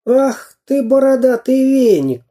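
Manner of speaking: exclaiming toy man. A narrator's reading. Samodelkin insults the bearded pirate, indignant high cry. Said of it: "воскликнул Самоделкин".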